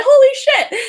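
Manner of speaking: a high, loud burst of disbelief; shocked exclamation. Retold or imitated. Woken by the dogs at four, she cries out in disbelief. Reacting to the puppy barking too.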